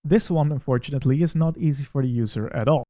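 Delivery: ordinary delivery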